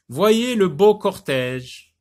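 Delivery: high, loud, slow recital; poem declamation. Acted. Teacher rereads a verse to pupils, loud slow declamation. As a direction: proclaim the verse, stretching it out.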